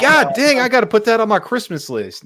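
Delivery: high, loud, laughing delight; laughing excitedly. Real, not acted. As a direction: gush through laughter. Delighted by the picture.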